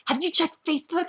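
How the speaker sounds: urgent friend imitation. Friend calling with breaking gossip, urgent high voice. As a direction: imitate an alarmed friend on the phone.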